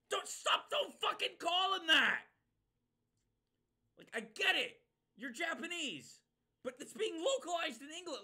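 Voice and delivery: loud, high, slowed yell; angry shout. Real, not acted. Streamer yelling at the dub to stop, loud and angry. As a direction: shout them down in real annoyance.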